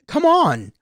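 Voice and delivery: exasperated. Real, not acted. Exasperated at gun-toting protesters.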